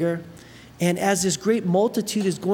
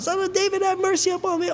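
much higher pleading cry; a blind beggar straining to reach Jesus, high pleading cry; mock scream; 12 semitones higher, faster than usual. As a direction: call out high as if over a crowd. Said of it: "he's screaming on the top".

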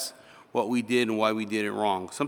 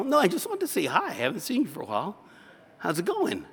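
high, quick friendly chatter; light reassurance; 9 semitones higher, faster than usual. Acted. Pastor reassuring a called-down student, high and breezy. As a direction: rattle it off high and casual.

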